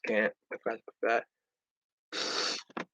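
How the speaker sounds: ordinary talk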